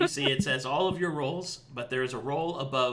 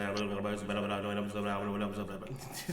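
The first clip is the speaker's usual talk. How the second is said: quiet, low stream of babbled data; rapid-fire gibberish; 5 semitones lower, 6 dB quieter than usual. Acted. Servitor answers a search query on runes, rapid low gibberish. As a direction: gabble nonsense syllables without a pause. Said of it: "starts saying so quickly".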